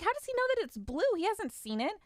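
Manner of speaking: high, fast, shocked disbelief; baffled that dad knows her redesign colour, high and fast. Retold or imitated. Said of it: "I was shocked".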